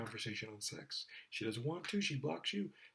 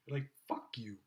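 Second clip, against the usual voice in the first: ordinary delivery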